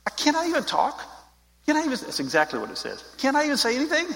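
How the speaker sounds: David protesting to his brother, high and whiny; indignant kid brother. Retold or imitated. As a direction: squeal back at the big brother like a wronged teen. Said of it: "He gets in his brother's face".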